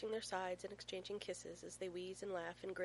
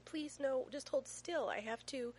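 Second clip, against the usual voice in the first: higher, louder exasperated plea; mildly harried begging; flustered pleading; 6 semitones higher, 4 dB louder, slower than usual. A narrator's reading. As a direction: plead with a wriggling toddler. Flustered dad pleading with squirming child.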